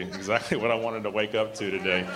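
amused, breaking into a laugh; chuckling through a retold reply; laughing retelling. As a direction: retell the friend's reply while starting to laugh. Laughs retelling friend's joking reply.

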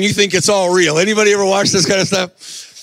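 normal talking voice

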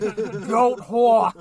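put-on shouted New York accent. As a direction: belt it in thick New York. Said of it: "He's like".

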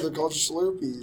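Slurpees said as shlurpees. Real, not acted. Friend insists they're called Slurpees, saying shlurpees. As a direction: start Slurpees with a sh sound. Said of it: "There's no H".

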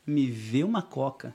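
slow, spelled-out "Me vê uma coca"; "Me vê uma coca" at a deliberate crawl; slow repeat. Shown as a demonstration. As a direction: draw out "Me vê uma coca" for scrutiny. Repeats phrase to highlight literal meaning.

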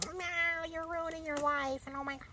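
squeaky high mock parent; high panicky parent. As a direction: shriek it as a dramatic parent. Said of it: "They'd be like".

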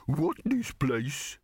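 high, slow, dopey dog voice; dim talking dog. A narrator's reading. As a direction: play a baffled, slow-witted hound. Slow-witted dog speaks in story.

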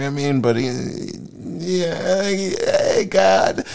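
high, hesitant caricature voice; put-on high-pitched devil voice; hammed-up Satan. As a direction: play a hedging, wheedling Satan.

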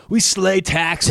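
slow, drawn-out bro voice. Pretend. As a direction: lay it on like a gym bro. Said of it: "that's like a real bro name".

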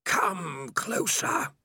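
slow, hissing sinister voice. Acted. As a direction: hiss it out, creeping and cold. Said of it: "all hisses".

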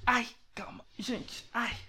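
pained whispered wince; sore back. Real, not acted. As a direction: gasp out in back pain. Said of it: "Ui, minhas costas estão doendo". (dor nas costas, sussurrando)

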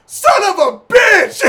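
high, drawn-out, full-volume yell; re-enacted furious outburst, very loud; yelled curse. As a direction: yell it slowly down the phone. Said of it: "hear me yell out".

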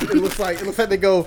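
ordinary delivery

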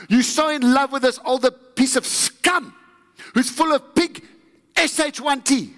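angry son ranting. Acted. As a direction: spit it out as the bitter older son. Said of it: "Look at the attitude of the older brother".

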